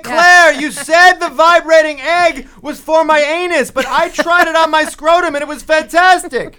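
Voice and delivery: high, slow, loud yell; yelling across the store. Pretend. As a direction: shout it over the shop music. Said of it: "having to yell to Claire".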